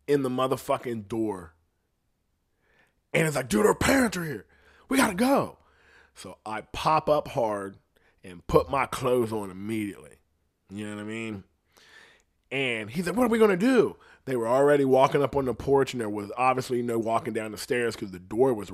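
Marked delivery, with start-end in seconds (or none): 3.5-5.6 high, urgent panicked friend; frantic warning to get out; panicked buddy. Retold or imitated. 13.2-14.0 high, loud scared whine; frantic friend asking what now; panicked question. Retold or imitated. Buddy panicking.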